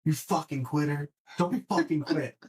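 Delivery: pumped-up yell at himself, pitch climbing; egging himself on like a drill sergeant; retold self-yelling. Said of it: "I was, like, screaming at myself".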